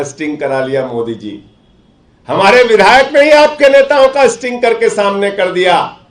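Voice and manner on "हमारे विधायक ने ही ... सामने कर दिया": high, forceful boast; political oratory. Real, not acted. Telling Modi about the sting on his leaders, forceful oratory. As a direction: proclaim it high and emphatically.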